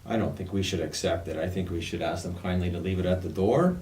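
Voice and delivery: ordinary talk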